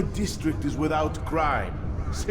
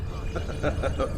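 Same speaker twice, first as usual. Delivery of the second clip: short breathy scornful laugh. Acted. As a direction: laugh him off with contempt. Villain mocks the assassin.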